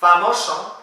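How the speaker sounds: ordinary talk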